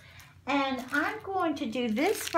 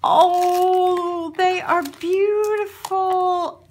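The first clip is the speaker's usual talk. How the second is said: gushing delight; 7 semitones higher, 4 dB louder, much slower than usual. Real, not acted. Gushing over gift.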